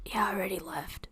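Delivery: hushed, quick whisper; quick, breath-only answer in secret; whispered reply. Acted. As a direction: whisper it back, barely any voice. Secret reply over sending stone.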